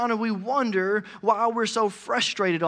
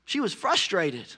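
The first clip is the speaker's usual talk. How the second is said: ordinary talk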